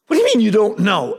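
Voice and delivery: high, quick, exasperated demand; incredulous parent. Retold or imitated. Parent challenging a child's excuse, incredulous. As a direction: mimic a parent who won't accept the answer.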